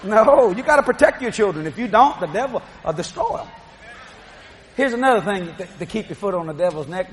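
usual speaking voice

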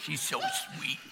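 put-on sweet baby talk to a dog. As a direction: coo it like talking to a pet. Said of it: "That's my dog voice".

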